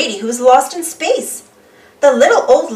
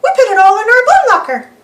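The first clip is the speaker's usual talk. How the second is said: high, fast moonman chatter; moonmen; 9 semitones higher, 4 dB louder, faster than usual. Acted. Moonmen showing off their locker, high and fast. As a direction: rattle it off high and quick. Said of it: "they say".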